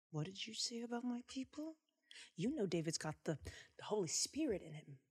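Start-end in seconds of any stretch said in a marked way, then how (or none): 0.1-1.8 quiet challenge. Acted. David voiced, quiet challenge.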